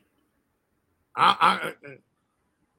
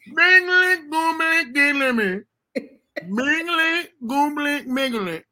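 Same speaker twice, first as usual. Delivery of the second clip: higher sing-song mumbled mingling sounds; mock mingling noises; 6 semitones higher, much slower than usual. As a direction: babble playfully like someone working the room. Mimicking man mingling around.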